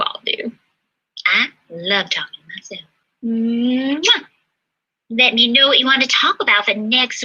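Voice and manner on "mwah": blown kiss. Real, not acted. To her viewers at sign-off, a smacking blown kiss. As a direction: blow a big kiss to the camera.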